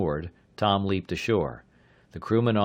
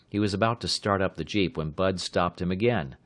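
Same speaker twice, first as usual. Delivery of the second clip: plain reading voice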